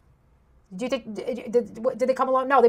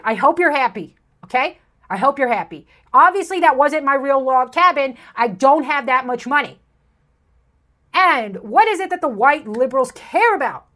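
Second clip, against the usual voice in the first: normal talking voice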